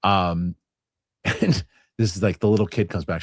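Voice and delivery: ordinary delivery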